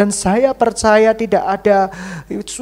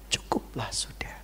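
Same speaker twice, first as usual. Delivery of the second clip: whispered, much softer; 8 semitones lower, 15 dB quieter than usual. Acted. Preacher whispers enough to the congregation. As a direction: let it fall to a bare whisper.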